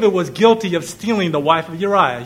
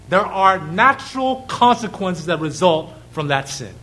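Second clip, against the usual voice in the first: usual speaking voice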